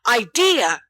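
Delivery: loud, high burst; sudden exclamation. A narrator's reading. James blurts his idea loudly to his friends. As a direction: James startles the group with a loud cry. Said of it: "James exclaims suddenly".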